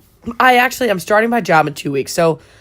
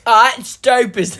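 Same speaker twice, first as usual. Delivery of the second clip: put-on higher, slow Australian accent; mock Australian accent; 5 semitones higher, 4 dB louder than usual. Quoting Lunatics to friends, mock Australian accent. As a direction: drawl it in a hammed-up Australian accent. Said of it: "we really like to quote".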